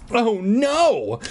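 lightly acted parents' shriek. A narrator's reading. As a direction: cry out high in alarm. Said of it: "I heard Mom and Dad shriek".

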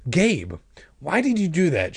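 high, exasperated question; demanding. A narrator's reading. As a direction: demand an explanation, mildly acted. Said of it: "she demanded".

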